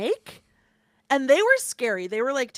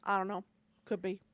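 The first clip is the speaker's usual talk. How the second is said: a hint of a deadpan little swamp boy, lower and softer; boy impression; 5 semitones lower, 9 dB quieter, faster than usual. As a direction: poorly imitate the boy who shows no emotion, low and soft. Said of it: "I'm gonna do an impression of this boy poorly".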